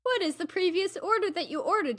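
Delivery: normal talking voice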